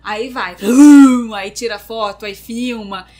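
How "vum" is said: loud rushing vum for the drop; whoosh sound. Retold or imitated. Describing the drop, a loud whooshing vum. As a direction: belt out vum like the car plunging.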